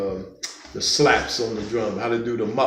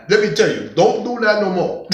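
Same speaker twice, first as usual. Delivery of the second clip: mock Puerto Rican accent, higher and louder; teacher's Puerto Rican accent; 8 semitones higher, 5 dB louder than usual. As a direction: imitate a Puerto Rican teacher's accent, laughing as you finish. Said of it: "He told me that in his accent".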